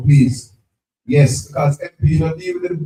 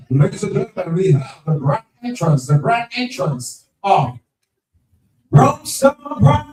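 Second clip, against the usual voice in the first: announcer voice. Real, not acted. MC announcing team grand entrance.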